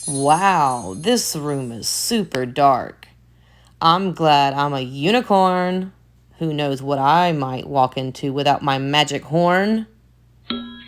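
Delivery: ordinary delivery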